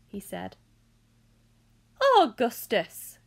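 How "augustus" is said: loud, drawn-out greeting cry; slow, loud exclamation of welcome; excited cry. A narrator's reading. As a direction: cry the name out with delight. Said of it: "cried Mister Wonka".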